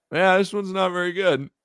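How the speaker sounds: high-pitched lukewarm verdict; unimpressed customers. Retold or imitated. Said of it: "people were like".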